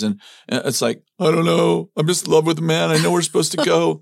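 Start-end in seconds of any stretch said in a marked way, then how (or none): 1.2-4.0 high, loud mock pleading; helpless lovestruck protest. Husband answering his practical wife's questions, helpless mock plea. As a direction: imitate yourself swept away, pitched up throughout.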